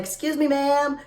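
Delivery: high put-on voice of a clerk; polite stranger. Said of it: "somebody's like".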